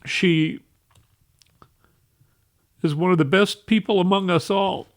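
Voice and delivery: holding back tears while praising her; tearful. Real, not acted. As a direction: voice rising and catching with tears. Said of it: "that I'm so weepy".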